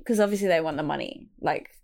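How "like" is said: ordinary delivery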